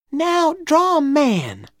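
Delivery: put-on high, slow girlish request; eager little girl. A narrator's reading. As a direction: ask slowly in a high girl's voice. Becky asks for a drawing.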